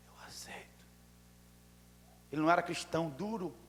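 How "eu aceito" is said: imitated weak, voiceless whisper; barely audible whisper of a patient; speaking low. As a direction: breathe it out in a faint whisper. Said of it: "tava falando baixo".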